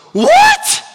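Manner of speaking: very high, loud yelp of disbelief; king's shocked outburst. Acted. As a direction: shriek it as a stunned question. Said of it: "The king goes".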